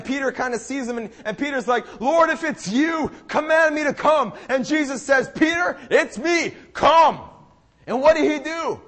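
loud, driving sermon delivery; impassioned preaching. Real, not acted. Preacher urging the church to keep eyes on Jesus, impassioned. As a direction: preach it urgently, punching key words. Preaches the story at full force.

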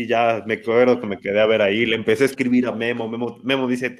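ordinary delivery

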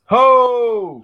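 high, drawn-out shouted cheer; big playful "Ho!"; battle cry. Acted. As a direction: belt it out like a cartoon rallying call. Completes the Thundercats battle cry.

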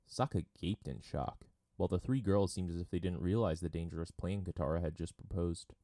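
ordinary delivery, nothing marked

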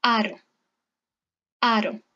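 "aro" with the R tapped once between vowels; careful "aro", no trill; soft Spanish R. Shown as a demonstration. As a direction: model "aro" carefully with a single-tap R. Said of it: "La R se pronuncia suave".

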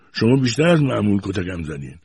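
ordinary delivery, nothing marked

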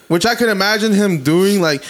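normal talking voice

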